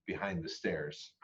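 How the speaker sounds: plain reading voice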